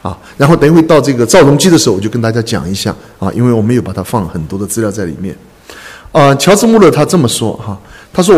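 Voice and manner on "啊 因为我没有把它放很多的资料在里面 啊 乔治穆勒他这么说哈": normal talking voice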